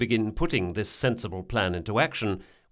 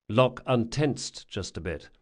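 ordinary delivery, nothing marked

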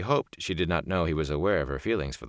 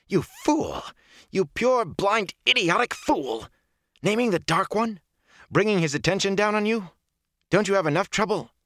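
high-pitched, exasperated tirade; furious scolding; 4 dB louder than usual. A narrator's reading.

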